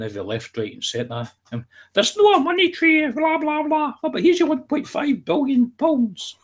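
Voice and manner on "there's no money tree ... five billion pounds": very high, slow silly voice; mocking politician voice. Mocking the government over the money tree, high silly voice. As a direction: squeak it out high and drawn-out in mockery.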